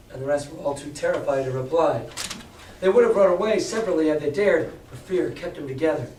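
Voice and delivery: usual speaking voice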